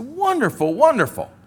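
high, sing-out reply, mildly hammed-up; acted delight. Preacher replying to the donor's gift offer, delighted. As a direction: exclaim it as a thrilled recipient.

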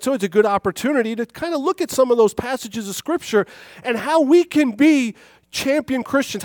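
rising, fervent sermon delivery; preaching. Acted. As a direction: preach it with building fire. Preaching at full force.

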